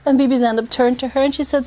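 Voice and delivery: ordinary talk, nothing marked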